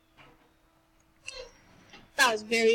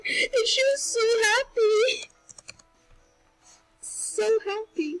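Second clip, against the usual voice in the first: much higher, slow and tearful; happy crying; 10 semitones higher, 3 dB louder, slower than usual. Real, not acted. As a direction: let joyful tears break the words. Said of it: "I'm kinda crying right now".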